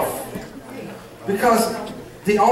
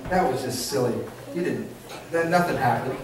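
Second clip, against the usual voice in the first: low, hushed devil talking someone down; devil's dismissive voice; 9 semitones lower, 9 dB quieter than usual. Acted. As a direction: drop low and soft, quietly planting doubt. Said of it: "The devil's gonna come to you and say".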